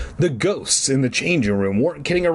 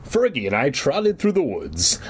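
ordinary delivery, nothing marked

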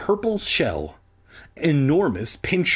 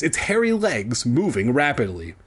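ordinary delivery

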